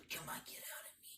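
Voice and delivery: strained, breathy whisper; mock Trump straining on the toilet. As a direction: whisper it while straining hard. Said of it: "trying to poop out whatever's stuck".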